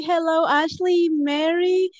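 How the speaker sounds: ordinary delivery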